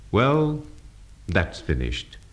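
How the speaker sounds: downward glide. Shown as a demonstration. Teacher showing a complete sentence, downward glide. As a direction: let "that's finished" drop to a full stop. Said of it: "the voice glides down".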